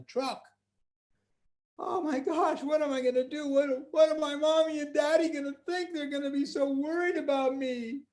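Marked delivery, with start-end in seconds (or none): 1.8-8.0 put-on high little-boy voice, rushing; a child fretting aloud; worried little boy. Trapped boy thinking of mommy and daddy, high worried voice. Boy worrying about his parents.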